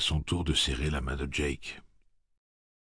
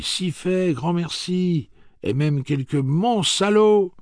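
high, loud villagers calling out; cheering crowd voices; 14 semitones higher, 8 dB louder, slower than usual. Acted. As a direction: throw it out joyfully from the crowd.

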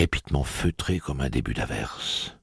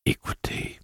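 suave whisper. A narrator's reading. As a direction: coax her in a near whisper. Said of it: "d'un ton si suave".

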